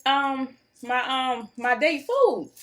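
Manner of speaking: usual speaking voice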